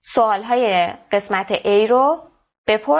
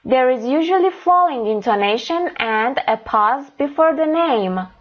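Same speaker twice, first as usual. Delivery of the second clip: slow careful reading; 3 semitones higher, slower than usual. Shown as a demonstration. Teacher reads the rule aloud.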